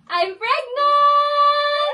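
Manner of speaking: high, loud, drawn-out shout; real, joyful shout; excited announcement. Real, not acted. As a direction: break the big news, stretching it out into laughter. Announcing pregnancy to family on call.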